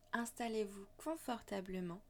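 guided meditation. Acted. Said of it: "Je vous invite à suivre ma voix lors d'une méditation".

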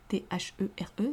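letters whispered softly; whispered spelling. Shown as a demonstration. Teacher whispering the letters to the class. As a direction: breathe out the spelling quietly.

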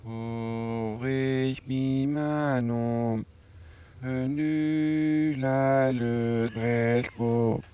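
"Aurais-je mis ma nombre" drawn out slowly, reversed sounds; slow deliberate reversed song line; backwards speech. Shown as a demonstration. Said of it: "on remet".

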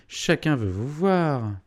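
lightly acted call, high and loud; slow, swooping delivery to an uncle; hearty greeting. A narrator's reading. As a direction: announce it to him with some show. Postmaster continues hailing his uncle.

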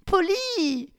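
very high, shocked cry of his name; shocked exclamation. Acted. Victoria cries out in shock as Polly stops the car. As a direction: cry out in alarm. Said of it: "s'exclama Victoria".

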